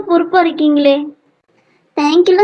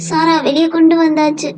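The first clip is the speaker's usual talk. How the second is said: normal talking voice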